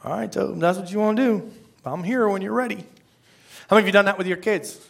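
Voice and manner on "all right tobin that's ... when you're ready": higher, lightly resigned patient voice; casual, indulgent fatherly concession; God, easygoing. Acted. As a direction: let them go ahead, gently and without pushing. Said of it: "That's how he talks to me".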